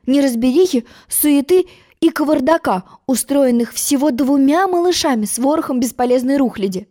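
ordinary delivery, nothing marked

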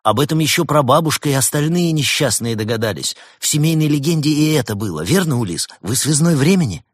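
high, loud, eager rush; joyful excitement. A narrator's reading. Лаэрт pressing Улис to confirm his guess, elated. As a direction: pour it out, thrilled at the guess. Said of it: "радуясь своей догадке".

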